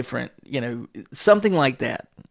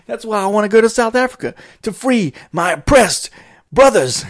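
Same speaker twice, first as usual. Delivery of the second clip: loud proud declaration; 10 semitones higher, 9 dB louder than usual. Retold or imitated. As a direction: declaim it like a cause. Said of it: "He says".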